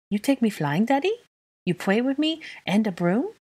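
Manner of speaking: put-on high, rising little-kid voice; pleading little child. A narrator's reading. Son asking Daddy to take him flying, hopeful. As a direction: tot eagerly begging to play. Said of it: "Aurelian asked".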